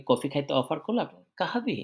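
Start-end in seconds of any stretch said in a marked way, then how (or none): 1.4-1.8 "কাহাবি" pitched high, as a question; question tune. Shown as a demonstration. Someone offering you coffee, high question tune on "কাহাবি". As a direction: ask "কাহাবি" in a raised pitch. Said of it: "শব্দটা পরে জানি একটু ই হবে".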